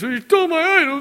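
high, loud whiny voice; petulant mock whining; whiny complaint. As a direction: whine like a robbed child. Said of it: "so he complains".

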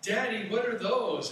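put-on a small boy points and asks; little boy voice. Little son asks his father, lightly voiced child. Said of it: "the little boy said".

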